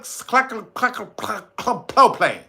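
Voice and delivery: stumbling over cl-, repeated before propane; tongue-tied. Real, not acted. Struggling with a chemical name, tongue-tied. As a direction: trip on the cl- syllable several times, then push out propane. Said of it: "this shit is hard to say".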